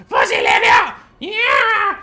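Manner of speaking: full-blast charging shout; rat battle cry. Acted. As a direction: scream a rat paladin's war cry, second word shrieked high. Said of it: "I'm just gonna be like".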